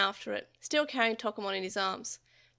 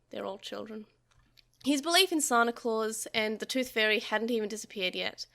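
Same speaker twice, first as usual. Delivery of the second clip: ordinary delivery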